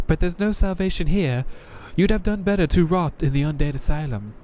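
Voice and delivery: a jaded knight greeting a newcomer; Crestfallen Knight voice. Acted. Knight warns player.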